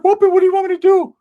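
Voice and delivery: very high, loud squeaky friend voice; Ricky's squeaky voice. Retold or imitated. Hyped friend squealing to the narrator, very high and loud. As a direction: pitch it way up and squeal it out. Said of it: "Ricky's like".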